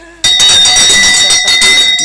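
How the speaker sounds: loud, breathy burst of laughter; big on-air laugh. Real, not acted. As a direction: crack up loudly on air. Laughing at banter.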